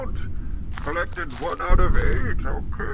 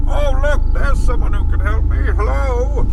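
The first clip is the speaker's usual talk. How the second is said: excited call; 3 semitones higher, 6 dB louder than usual. Acted. Spots a stranger in woods.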